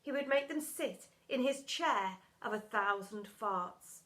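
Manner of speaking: ordinary delivery, nothing marked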